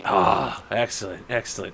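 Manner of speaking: low, very soft gloating; hushed delight. Acted. Guard receiving the autograph he wanted, hushed satisfaction. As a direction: savor it under your breath.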